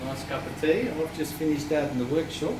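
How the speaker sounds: playful put-on Afrikaans accent; mock Afrikaans accent. Husband teasing wife over tea in mock Afrikaans accent. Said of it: "Ten points for guessing what accent that was".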